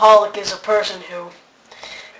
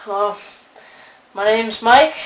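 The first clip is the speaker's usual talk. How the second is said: normal talking voice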